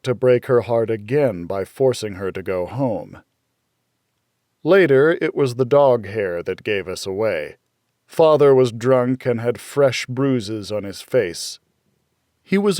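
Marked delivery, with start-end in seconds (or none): none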